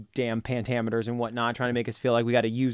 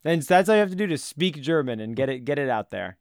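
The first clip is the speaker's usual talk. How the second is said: ordinary talk, nothing marked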